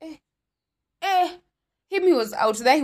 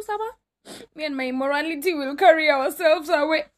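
mock tearful; 6 semitones higher than usual. Replying to pastor, mock crying.

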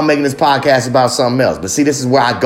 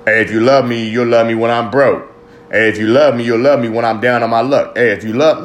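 lower, pleading voice of a man who wants to be carried; mock pleading man; 4 semitones lower than usual. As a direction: lightly imitate a needy man asking for love. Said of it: "it's something for men to take advantage of".

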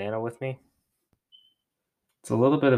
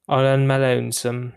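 attempted British accent, a touch raised; 4 dB louder than usual. Shown as a demonstration. Alone on the podcast, trying out a British accent. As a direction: put on an amateur British accent. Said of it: "Is that a pretty good British accent?".

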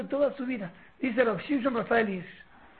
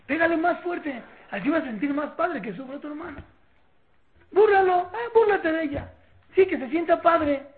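loud, higher-pitched sarcastic encouragement; mock egging-on; 6 semitones higher, 7 dB louder than usual. Parent to a child teasing a sibling, mockingly egging on. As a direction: goad them on with exaggerated approval.

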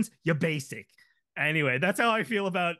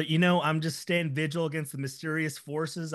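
ordinary talk

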